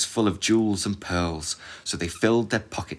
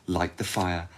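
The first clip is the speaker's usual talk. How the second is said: ordinary delivery, nothing marked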